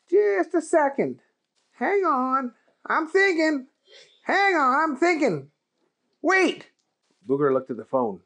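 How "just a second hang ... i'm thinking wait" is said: high, fussy, distracted; mumbling while thinking hard; Keith voice.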